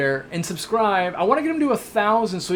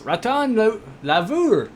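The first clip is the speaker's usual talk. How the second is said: rough go at a French accent; 5 semitones higher, slower than usual. Shown as a demonstration. Streamer showing his French would pass, amateur French accent. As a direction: have a confident stab at sounding French. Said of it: "That's my best French accent".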